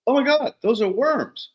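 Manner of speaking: high-pitched mock amazement; retold wide-eyed astonishment; astonished discovery. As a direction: exclaim in amazement, voice way up. Old farmer retold discovering worms.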